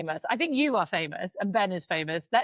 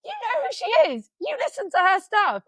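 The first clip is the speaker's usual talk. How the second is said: high, loud incredulous questions; stunned disbelief; 11 semitones higher, 5 dB louder than usual. Retold or imitated. Neighbor grilling the fan in disbelief, high and loud. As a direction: act as if you can't believe your ears. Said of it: "got right up in her grin and was like".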